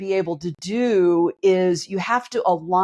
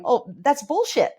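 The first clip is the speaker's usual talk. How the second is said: dismissive scoff; 7 semitones higher than usual. Retold or imitated. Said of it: "my body's going".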